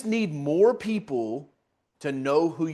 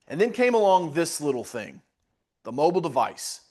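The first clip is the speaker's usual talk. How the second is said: normal talking voice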